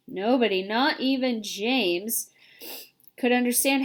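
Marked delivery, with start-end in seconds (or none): none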